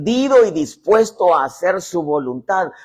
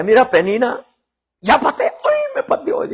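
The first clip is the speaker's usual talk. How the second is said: mocking rival; 8 semitones higher, 4 dB louder than usual. Ana's pregnant rival mocks her.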